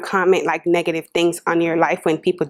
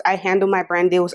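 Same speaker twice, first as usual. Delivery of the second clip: ordinary talk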